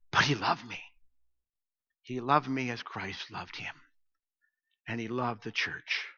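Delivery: low, very soft tribute; tender hush. Real, not acted. Pastor remembering Bob Hill's love for him, tender and hushed. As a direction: drop low and soft, with reverence.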